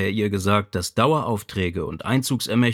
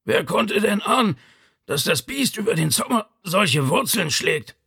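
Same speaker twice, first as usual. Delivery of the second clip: high-pitched, pained father; aggrieved ailing old man; Vito; 9 semitones higher than usual. A narrator's reading. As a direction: complain from the sickbed in a strained high voice.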